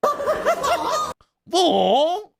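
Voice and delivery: a scandalised English lady shrieking; mock English gasps. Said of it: "shitty English accents".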